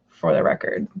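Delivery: low, breathy joking whisper; a conspiratorial whisper under the breath; whispered aside. Real, not acted. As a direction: let it slip out as a secret aside. Joking aside about keeping weed.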